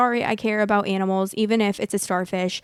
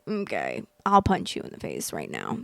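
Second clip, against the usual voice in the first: much lower, softer, menacing fantasy line; mock threat; 5 dB quieter than usual. To the rude mom at the aquarium, a low mock menace. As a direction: drop way down and quietly threaten.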